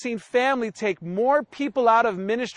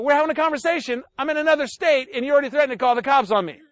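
normal talking voice

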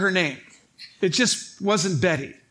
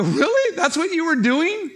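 high, loud disbelief; can't believe it, loud and high; incredulous; 10 semitones higher, 6 dB louder than usual. Retold or imitated. Incredulous reply in the retold scene.

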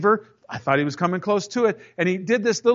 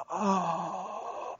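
stunned quiet oh; 12 dB quieter than usual. Retold or imitated. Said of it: "they're just like".

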